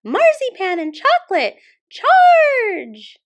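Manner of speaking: very high, very loud squeaking charge; rally the mouse troops with a shriek; shrieking mouse general's command; mouse battle cry. Acted. Mouse general orders attack.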